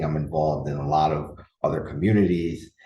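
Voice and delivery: ordinary talk, nothing marked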